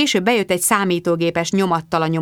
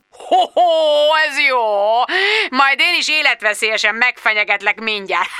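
high, hooting laugh into a mock threat; gleeful mockery; 7 semitones higher than usual. A narrator's reading. Boss laughing off his employee's death threat, gleeful mockery. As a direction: hoot with derision, pitched well up.

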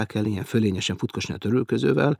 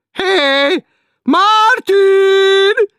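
much higher, loud drawn-out shout; sharp drawn-out woman's call; 15 dB louder, much slower than usual. Acted. Woman calling Martin from below, shrill drawn shout. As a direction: yell up from the depths like a shrill woman. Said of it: "ellenszenvesen éles, nyújtott női hang".

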